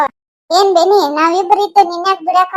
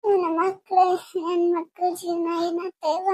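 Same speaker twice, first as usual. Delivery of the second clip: soft, weeping worry; mother crying softly for her children; tearful lament; 8 dB quieter, slower than usual. Acted. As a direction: lament through quiet sobs, trailing away. Mother weeps over her children's future.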